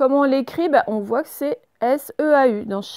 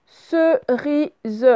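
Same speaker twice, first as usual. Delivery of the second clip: higher, slowly split into syllables; 5 semitones higher than usual. Shown as a demonstration. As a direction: sound it out for pupils. Correction, découpe le mot en syllabes.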